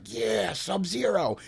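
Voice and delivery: slow, dragged-out lone cheer; mock a lonely fan cheering in the back; half-hearted cheer. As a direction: moviegoer cheering from the back of an empty theater, slow and drawn out. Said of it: "and I was like".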